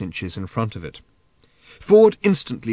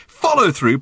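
protesting; 8 semitones higher, 5 dB louder than usual. A narrator's reading. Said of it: "protested Arthur".

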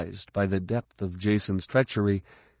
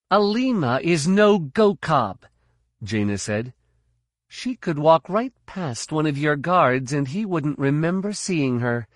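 higher, urgent female warning; young woman's voice; 7 semitones higher, 4 dB louder than usual. A narrator's reading. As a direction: warn him with raised pitch. Jaina warns.

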